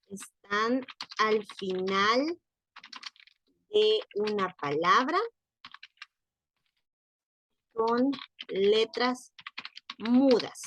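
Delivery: words drawn out with long gaps; slow dictation. Real, not acted. Teacher writing out notes for students, slow dictation. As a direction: dictate slowly at writing speed.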